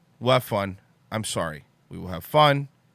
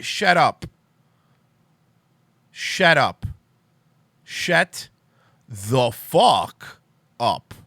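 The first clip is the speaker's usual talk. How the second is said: angry rant; much slower than usual. Real, not acted. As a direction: drag out the last one, slow and bitter. Ranting at Orlando's pandering.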